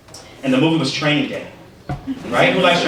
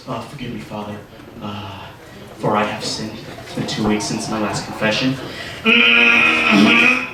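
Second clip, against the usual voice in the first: confessing sinner; 5 semitones lower than usual. Acted. Sinner whispering his sins to the priest, lightly voiced low and hushed. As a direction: murmur it low like a nervous penitent in the booth.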